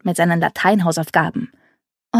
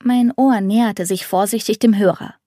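plain reading voice

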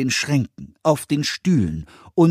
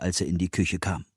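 plain reading voice

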